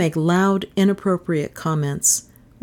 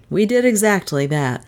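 ordinary delivery

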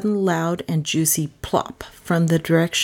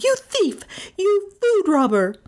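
high-pitched furious accusation; outraged shout; 14 semitones higher than usual. A narrator's reading. Melissa accusing Carstairs of stealing food, shouting. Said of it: "Melissa shouted".

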